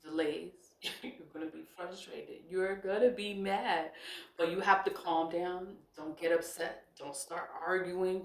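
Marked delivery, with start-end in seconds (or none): none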